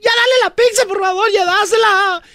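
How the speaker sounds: pleading worker. Retold or imitated. Host voicing the frightened pizzeria worker, pleading. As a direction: beg the man nervously. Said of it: "Y la morra que trabaja ahí".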